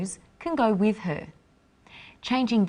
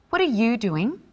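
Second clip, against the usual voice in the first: "you" lifted, with a questioning rise; curious and interested; 2 semitones higher than usual. Shown as a demonstration. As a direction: ask with friendly interest. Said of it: "is asking a question".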